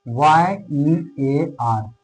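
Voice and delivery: Y, E, A, R spelled out one by one, very slowly; letters spelled slowly. Shown as a demonstration. Teacher spells a word for students, very slowly. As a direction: dictate each letter with long gaps. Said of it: "spelling letters out slowly".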